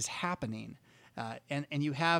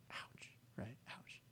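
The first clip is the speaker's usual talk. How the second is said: whispered ouch; 5 semitones lower, 20 dB quieter, slower than usual. Acted. Wincing aside after confession.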